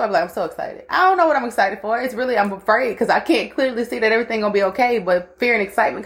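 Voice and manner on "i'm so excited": quiet mantra. Shown as a demonstration. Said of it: "when I'm fucked up".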